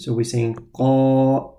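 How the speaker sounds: normal talking voice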